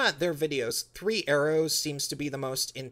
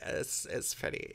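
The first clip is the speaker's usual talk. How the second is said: hushed, breathy aside; whispering; 8 dB quieter than usual. Real, not acted. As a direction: whisper it like a secret. Repeats the verdict in a whisper.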